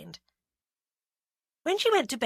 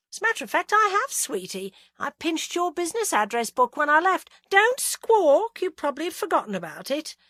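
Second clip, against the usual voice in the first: higher, camp chatty voice; breezy and playful; Roy, young PR man; 8 semitones higher, 7 dB louder than usual. A narrator's reading. Roy chatting to Agatha on the phone, higher and breezy.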